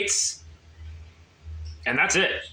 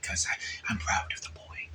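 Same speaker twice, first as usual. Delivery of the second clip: soft whispered confession; 12 dB quieter than usual. Acted. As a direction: whisper it like a secret. Whispered aside.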